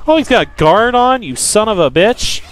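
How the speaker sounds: normal talking voice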